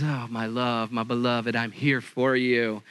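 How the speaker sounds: low, soft lovestruck plea; a hint of a pleading husband; tender mock husband. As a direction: coo it like a husband wooing.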